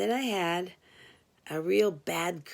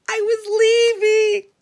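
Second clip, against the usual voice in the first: much higher, much louder burst of joy; excited; 13 semitones higher, 9 dB louder than usual. Real, not acted. Narrator thrilled to walk out the door for good. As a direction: burst out the word as if set free. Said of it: "could barely contain my excitement".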